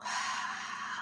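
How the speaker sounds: very quiet breathy letting-go exhale; gentle exhale; soft sigh. Shown as a demonstration. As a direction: release the breath in a faint sigh. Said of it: "releasing, letting go".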